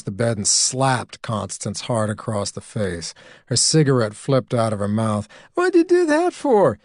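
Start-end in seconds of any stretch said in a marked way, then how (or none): 5.5-6.8 high, loud shocked outcry after a slap; shocked cry. Acted. Connie slapped, shocked outcry.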